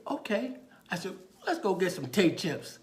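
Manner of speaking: high, loud agreement; talking to a child. Retold or imitated. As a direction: go along gamely with a little kid. Officer humoring a small child.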